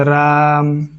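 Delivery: drawn-out call of a name. Shown as a demonstration. Teacher shows how to call out Raam. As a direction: call the name across a distance. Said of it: "इस तरीके से चिल्लाते हैं".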